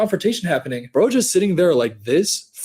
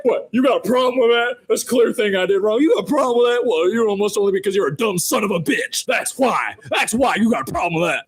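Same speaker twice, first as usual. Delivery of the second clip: mock defiant old man; 5 semitones higher than usual. Imitating the old man's defiance.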